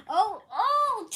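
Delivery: loud, high "oh, oh!" with noises; big mock-alarmed outburst; playful sound effects. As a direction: exclaim it like a cartoon crash effect. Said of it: "I'm just doing sound effects".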